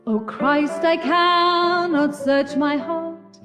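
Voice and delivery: ordinary delivery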